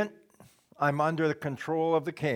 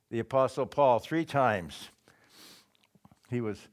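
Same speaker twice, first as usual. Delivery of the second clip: normal talking voice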